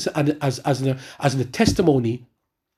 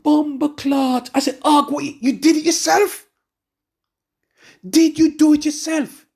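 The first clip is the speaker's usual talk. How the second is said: shocked exclaim; 10 semitones higher than usual. Retold or imitated. Retold shock at brother's news.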